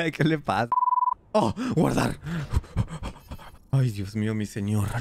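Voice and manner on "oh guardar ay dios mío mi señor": ordinary delivery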